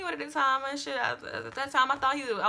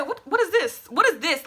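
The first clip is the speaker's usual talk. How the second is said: higher, louder "What is this?"; confronting; 5 semitones higher, 5 dB louder than usual. Retold or imitated. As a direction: confront him over the roses. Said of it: "I wanted to get so loud".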